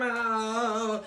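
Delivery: ordinary delivery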